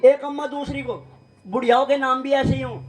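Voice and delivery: ordinary talk, nothing marked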